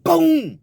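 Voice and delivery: loud, high imitated boom; loud mouth sound for a knockout hit; punch sound effect. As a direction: burst out a punch noise. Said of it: "Dude hit him so hard".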